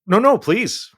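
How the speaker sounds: high, loud no no please; a high, loud real protest; eager insisting. Real, not acted. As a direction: insist warmly that he go ahead. Urging the other to speak.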